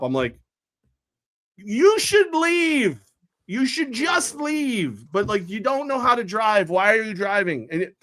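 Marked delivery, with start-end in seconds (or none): 1.6-7.7 very slow, much higher, condescending; retold dismissal. Father retelling telling the driver to leave, slow high condescension. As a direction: spell it out like to a child, high and slow.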